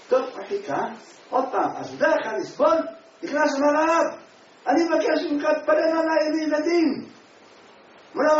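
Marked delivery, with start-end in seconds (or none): 3.8-7.1 loud, high desperate plea; pleading loudly. Retold or imitated. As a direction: beg the rabbi with all you have. Childless man begs the rabbi. (תחינה רמה ונואשת)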